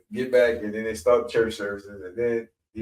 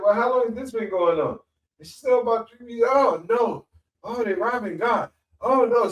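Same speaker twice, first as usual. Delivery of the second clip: high, fretful preacher voice, much higher; higher pitched worried pastor crying oh no; alarmed pastor; 10 semitones higher than usual. Retold or imitated. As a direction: play the shocked old reverend. Pastor alarmed at missing kids.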